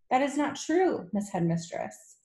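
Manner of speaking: firm but courteous teacher; protesting young teacher. Acted. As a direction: stand up to the boss without shouting. Miss Honey protests to headmistress.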